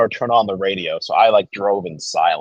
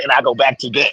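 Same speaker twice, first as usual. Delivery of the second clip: usual speaking voice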